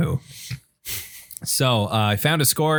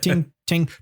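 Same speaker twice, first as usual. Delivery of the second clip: high, ringing vocal cymbal hits; ting it out like a cymbal count-in; imitated China cymbal; 6 semitones higher than usual. Mimicking the cymbal before a breakdown, imitated cymbal hits. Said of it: "And then China cymbal".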